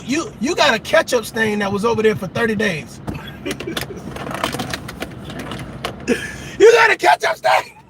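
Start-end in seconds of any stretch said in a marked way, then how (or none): 3.1-6.5 breathy burst of laughter. Real, not acted. Comic laughing at the ketchup stain joke. 6.6-7.8 higher, louder mock shout; mock yell. Comic yelling at a messy guy about a stain.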